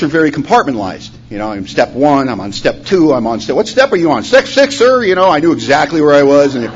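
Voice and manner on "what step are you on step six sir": high, snappy recruit-style reporting; mock drill exchange. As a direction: act out an eager answer to a superior. Mimics drill-like question and answer.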